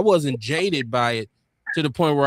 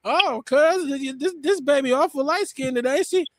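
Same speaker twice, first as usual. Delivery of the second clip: high, sly mock cousin voice; older cousin teasing the new dad; 9 semitones higher than usual. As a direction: needle him about the light-skinned baby, way up high. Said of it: "she said".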